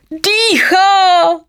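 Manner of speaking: very high, drawn-out jubilant cry; joyful shout. A narrator's reading. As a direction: cry out the great news at the top of the voice. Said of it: "radostně volal". (jásavý, vysoký výkřik)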